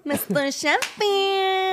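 put-on high, drawn-out character-voice accent; affected accent, long high call. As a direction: stretch the name out high in an accent. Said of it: "you do your accent".

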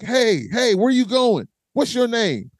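calling after her, teasing; mock holler, pitched well up; Rich calling out. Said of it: "So Rich was like".

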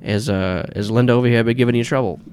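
cigar-chewing New York boss, pressing on; Gordon Pym. Acted. Boss asks visitor about Linda, slight New York accent. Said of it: "he has, like, this slight New York accent".

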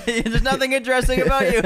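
high mocking put-on voice; teasing voice. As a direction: tease in a high squeaky voice.

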